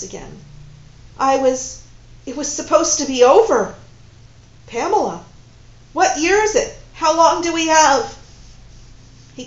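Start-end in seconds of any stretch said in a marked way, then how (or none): 1.2-3.8 distressed. Acted. Dismay at being back. 4.7-8.2 higher, louder anxious questions; urgent. Acted. Woman asking her lover how much time is left, urgent and anxious.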